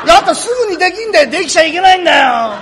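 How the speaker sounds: high, loud, fast exasperated outburst; やけ気味の叫び. Acted. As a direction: burst out in frustration once outside. 空いた床屋を出てぼやく. (やけになって高く早口で叫ぶ)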